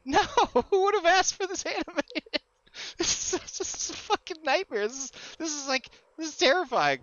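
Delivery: high-pitched, laughing outrage; appalled and half laughing; retold horrified protest. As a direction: protest in mock horror, voice way up. Retold horrified answer to the artist.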